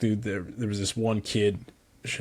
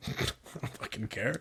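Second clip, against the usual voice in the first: muttered aside; 11 dB quieter than usual. Retold or imitated. Muttered reply to the kids.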